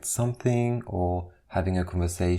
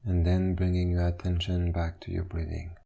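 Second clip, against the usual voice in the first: guided meditation. Acted. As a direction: ease them into stillness, one instruction at a time. Leads a standing meditation.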